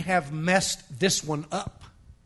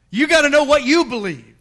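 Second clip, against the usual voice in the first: loud, higher-pitched preaching push; emphatic exhortation; 5 semitones higher, 7 dB louder than usual. Real, not acted. Preacher urging the congregation to know their beliefs, emphatic. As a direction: press it on the congregation, louder. Preacher driving a point home.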